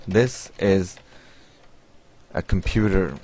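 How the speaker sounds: slow, word by word, long pauses; dictating while typing. Real, not acted. Teacher typing a sample C message for learners, slow dictation. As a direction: say it softly in pieces as you type.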